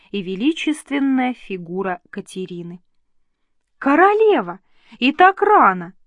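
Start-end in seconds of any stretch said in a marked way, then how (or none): 3.8-5.9 a high, loud cry of surprise at the early visit; exclaiming in surprise. A narrator's reading. Elizabeth greets the queen at her door, loud and surprised. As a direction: burst out, startled and delighted. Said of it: "воскликнула Елизавета".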